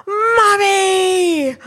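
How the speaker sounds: put-on high, loud, wailing child calling mummy; desperate child's cry. Mother imitating her son's panicked wake-up call. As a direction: cry out like a panicked little boy. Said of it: "cry of desperation".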